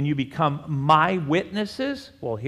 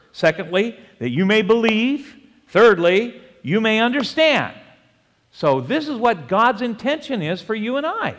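raised, high preaching voice; emphatic preaching; 7 semitones higher, 5 dB louder than usual. Acted. Preacher listing God's intentions for the congregation, emphatic. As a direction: drive each point home to the congregation. Preaches the verse's points with force.

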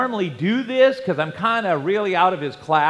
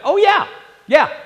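high, loud mock-confident neighbour; eager assurance; 11 semitones higher, 6 dB louder than usual. Neighbour insists he can quit, imitated eager assurance. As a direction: imitate an over-quick assurance, pitched way up.

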